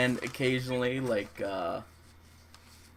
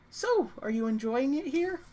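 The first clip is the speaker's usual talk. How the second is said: very high, put-on hostess voice; eager innkeeper woman. Innkeeper addressing a returning guest, high and eager. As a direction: ask it in a high-pitched hostess lilt. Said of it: "the woman, uh, addresses you".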